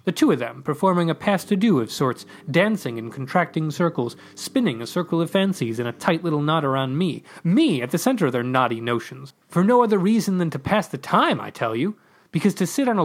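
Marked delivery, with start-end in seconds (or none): none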